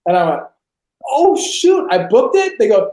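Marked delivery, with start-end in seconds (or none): 1.1-2.6 high-pitched astonished reaction; acted surprised. Actor hears from manager he booked the part, astonished. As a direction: blurt it in mock disbelief, rising at the end.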